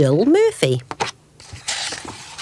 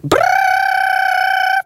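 very high, long trilled brrr; ringing alarm clock. A narrator's reading. Alarm clock shattering Mister Bear's peace, high trilled ringing. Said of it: "went the alarm clock".

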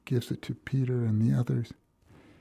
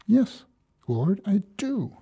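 a lightly voiced Peter answering, much higher; a hint of an eager disciple, higher, the last words slowed; Peter's earnest answer; 9 semitones higher, slower than usual. Peter assuring Jesus of his love, lightly voiced and higher.

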